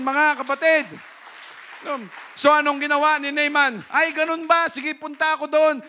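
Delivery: loud, high pulpit voice; fiery preaching. Acted. Preacher rousing the brethren, loud and high. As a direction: drive the point home to the congregation.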